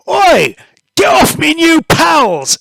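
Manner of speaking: loud, high character yell; angry shout. Acted. Mister Haydington defending his new pals, angry shout. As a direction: holler a drawn-out warning at attackers.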